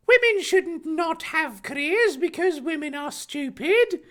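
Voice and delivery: very high, strained cartoon voice; high character voice. Harry arguing about women and careers, high put-on voice. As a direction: put on a silly high-pitched figure.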